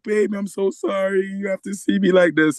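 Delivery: high-voiced imitation of a crying wife; mock tearful apology. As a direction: plead an apology, lightly acting tearful. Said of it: "like, crying".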